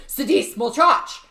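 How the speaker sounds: loud, slow barked Russian order; stern Russian command. Retold or imitated. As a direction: bark it like a strict father. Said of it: "He did sometimes say".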